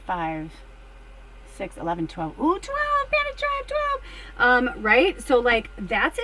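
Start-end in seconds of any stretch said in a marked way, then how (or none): 2.4-4.0 high excited sing-song; real delighted squeal. Real, not acted. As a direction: squeal over the lucky number. Excited about the number.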